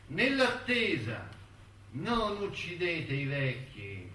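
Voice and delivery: high, insistent declamation in a repeated refrain; impassioned appeal. Acted. Speaker begs his friends not to kill while waiting, impassioned appeal. Theatrical plea to spare creatures.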